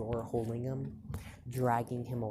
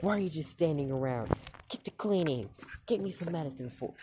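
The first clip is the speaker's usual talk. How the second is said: higher, hurried scolding orders; 5 semitones higher, faster than usual. Acted. Mom snapping orders at an idle Deku, scolding. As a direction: order them about impatiently. Retold mother scolding a bystander.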